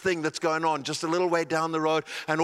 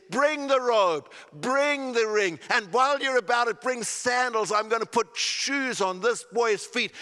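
father calling out; 6 semitones higher than usual. Acted. Father commanding the servants.

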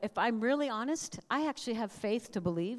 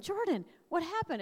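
surprised question; 8 semitones higher than usual. Retold or imitated. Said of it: "they all stopped and went".

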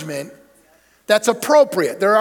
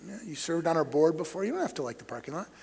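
much softer, gentle retelling; quietly reassuring the church member; soft retold reassurance; 4 semitones lower, 13 dB quieter than usual. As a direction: hush it down, calm and kind. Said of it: "I said".

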